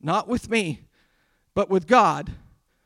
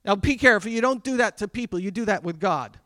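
ordinary talk

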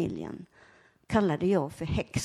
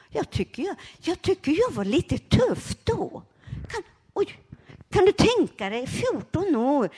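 proud; 8 semitones higher than usual. Real, not acted. Proud of her younger self.

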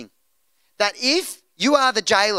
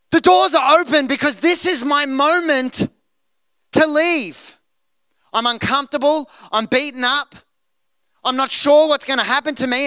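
higher, louder self-justifying plea; mock eager escapee; 4 semitones higher, 5 dB louder than usual. Preacher imagining himself fleeing jail, hammed-up and loud. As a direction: talk yourself into leaving, playfully. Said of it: "I would have been like".

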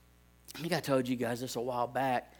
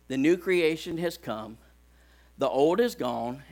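ordinary talk

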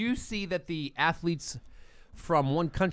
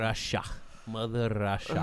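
fake Russian accent; 6 semitones lower, 6 dB quieter than usual. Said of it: "That's not a Russian accent".

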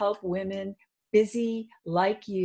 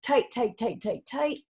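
higher-pitched, tense rapid repetitions; urgent squeezing cue; 5 semitones higher than usual. Shown as a demonstration. Coach leading a full-body muscle squeeze, urgent and tense. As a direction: urge them to squeeze harder with each word.

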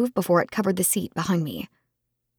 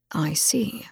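soft breathy whisper; whispered I see; 3 semitones lower, 5 dB quieter than usual. Acted. Friend considering her fate, hushed whisper. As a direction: let it out under your breath, thinking.

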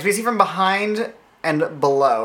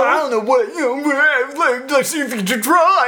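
higher, louder mock douchebag challenge; hammed-up douchey dare, higher and louder; douchey guy voice; 9 semitones higher, 3 dB louder than usual. Cohost daring the ghost, higher, louder douchey guy. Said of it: "douchey guy voices".

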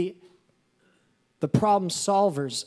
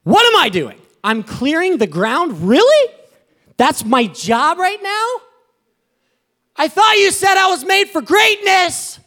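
much higher and louder, shouting at God in disbelief; exasperated outcry; 9 semitones higher, 10 dB louder than usual. Retold or imitated. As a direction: cry out up high, fed up with clearing ground. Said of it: "You're like".

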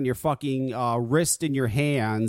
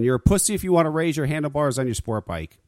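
ordinary talk

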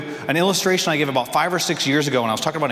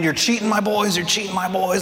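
a touch of an indignant coach; understated protest at the refs; mild complaining; 5 semitones higher than usual. Retold or imitated. Coach complaining to the ref, lightly indignant. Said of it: "He's complaining".

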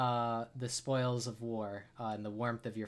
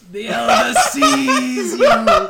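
laughing fumble; 12 semitones higher, 19 dB louder, slower than usual. Real, not acted. GM breaks into laughing high voice.

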